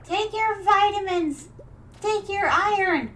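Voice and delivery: high, loud nagging mom; mother yelling. Retold or imitated. Mother yelling at her tired daughter to take vitamins. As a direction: nag like a mother on your case. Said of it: "my mother's always yelling".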